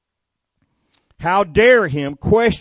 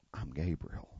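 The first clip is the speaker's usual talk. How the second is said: "I'm Gabriel" said low and much softer; meek murmur; 10 semitones lower, 17 dB quieter than usual. Shown as a demonstration. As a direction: shrink the name to a timid murmur. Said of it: "he didn't just say".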